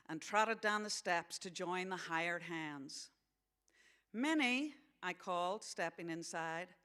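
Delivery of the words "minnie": calling out. Acted. Calling a friend on entering.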